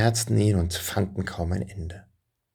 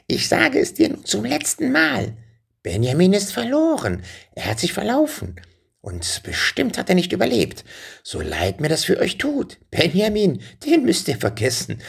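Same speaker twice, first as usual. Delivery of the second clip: very high-pitched, insistent witch voice; impatient witch; 12 semitones higher than usual. A narrator's reading. Witch telling the king Benjamin is lost, impatient and high. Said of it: "Die Hexe stampfte ungeduldig mit den Füßen auf".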